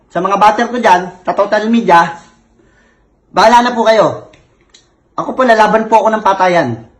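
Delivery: normal talking voice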